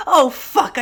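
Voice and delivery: loud, high outburst of mock alarm; sudden loud curse in feigned dread. Narrator realizing they just mentioned verbs, mock alarm.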